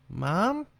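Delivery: calling out for his mom uneasily; little boy calling. A narrator's reading. Scared boy calls again to his silent mother, high and uneasy.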